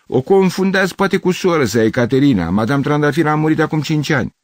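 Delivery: high, loud explanation; firm correction. Acted. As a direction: set the confused man straight from a window. Neighbour loudly corrects him.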